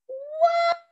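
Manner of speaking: very high-pitched, drawn-out exclamation; startled whoop. Acted. Duck and Hippo collide at the door, startled whoop. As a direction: yelp as if bumped into someone.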